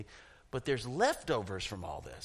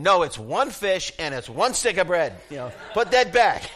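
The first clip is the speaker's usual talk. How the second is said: louder, bossy refusal; stingy scolding; 5 semitones higher, 11 dB louder than usual. Pretend. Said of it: "I'd be walking around saying".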